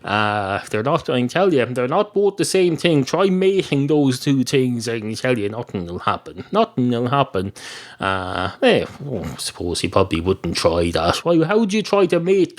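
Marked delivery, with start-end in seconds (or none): 5.5-6.4 muttered. Shown as a demonstration. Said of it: "repeats same words".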